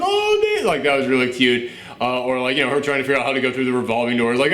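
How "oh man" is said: very high, drawn-out melting coo; retold high squeal of adoration. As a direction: go all soppy over something cute, pitched way up. Retells cooing reaction to cute baby scene.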